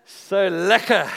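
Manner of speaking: slow, loud crow of delight; gleeful gloat. Real, not acted. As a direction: savour the win out loud, stretching every sound. Gloating over the rugby win.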